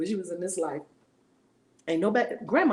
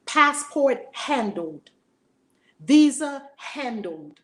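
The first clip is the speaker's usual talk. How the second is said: slow, louder, each word landed; emphatic declaration; 4 semitones higher, 8 dB louder, much slower than usual. Acted. Preacher proclaiming everything was handled, slow and emphatic. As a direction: hammer home HANDLED. Triumphant list of things handled.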